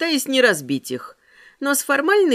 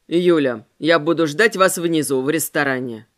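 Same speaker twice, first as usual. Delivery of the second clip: lower man's voice; 7 semitones lower than usual. Acted. Ramirez voiced.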